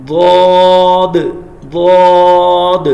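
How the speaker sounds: ضاد said loud and very slow; heavy duad. Shown as a demonstration. Teacher drills ضاد for the class. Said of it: "next one".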